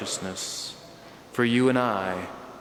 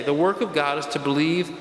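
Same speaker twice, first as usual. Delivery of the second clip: usual speaking voice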